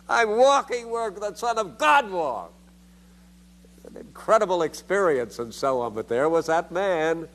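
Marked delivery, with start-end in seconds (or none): 0.1-2.5 high, ringing cry of amazement; retold joyful scream. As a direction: cry it out in wonder, pitched high. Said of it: "an amazement that I screamed out".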